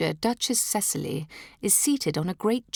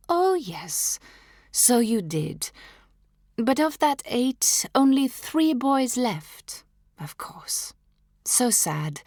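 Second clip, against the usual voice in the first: mock sympathy; 7 semitones higher, slower than usual.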